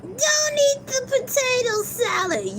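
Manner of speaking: high-pitched mock alarm about the potato salad; warning squeal. Shown as a demonstration. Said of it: "what is the key thing".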